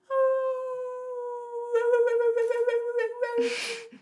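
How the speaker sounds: long, high, sustained dog howl; imitated dog howl. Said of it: "The fucking dog starts his howling".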